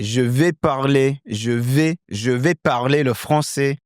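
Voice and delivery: lighter French-accented delivery; native French accent. Shown as a demonstration. Teacher becoming Clément, native French accent. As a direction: say it with French vowels and tune. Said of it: "now I'm adopting that character".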